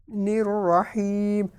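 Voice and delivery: very slow رحیم with the ee held; long ya. Shown as a demonstration. Teacher showing the madd in رحیم. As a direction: hold the ee in رحیم. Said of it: "یا ساکن سے پہلے زیر ہے تو یہاں پر مدہ ہوگا".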